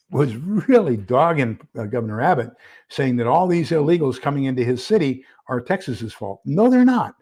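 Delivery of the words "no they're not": sharp, higher and louder denial; indignant retort. Real, not acted. Rebutting the Chicago mayor, an indignant higher, louder no. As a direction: fire back at the mayor.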